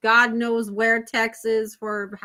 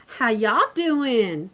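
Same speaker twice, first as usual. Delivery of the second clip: slow, drawled Southern "How y'all doing"; much slower than usual. Shown as a demonstration. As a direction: drawl it like a Texan. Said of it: "See, I do it like y'all now".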